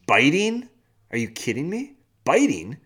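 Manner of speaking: incredulous, pitch jumping up on the key word; can't believe it, indignant; exasperated disbelief. Real, not acted. Dad appalled that his kid started biting, incredulous. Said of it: "piss me off".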